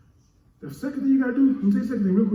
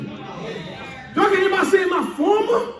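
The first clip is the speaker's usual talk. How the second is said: preaching call and response; 10 semitones higher than usual. Acted. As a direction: rouse the congregation.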